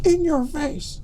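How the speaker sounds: very high, squeaky little voice; teeny falsetto taunt; little voice in the head. Retold or imitated. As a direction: pipe up in a tiny, high mocking squeak. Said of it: "a little voice in the side of my head saying".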